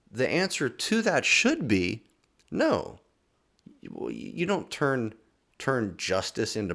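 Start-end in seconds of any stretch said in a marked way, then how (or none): 2.5-3.0 NO jumped way up high; emphatic no. Shown as a demonstration. Pastor answering God's rhetorical question, emphatic. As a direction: land No as the obvious answer. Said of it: "an emphatic no".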